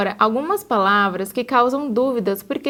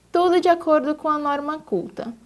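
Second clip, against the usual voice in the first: ordinary talk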